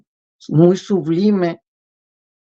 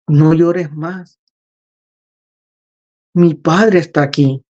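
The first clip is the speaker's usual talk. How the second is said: slow, lightly voiced soothing words; calm him down without hurry; a touch of tender reassurance; slow gentle comfort. Jesus comforting, very slowly.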